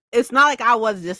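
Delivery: ordinary talk, nothing marked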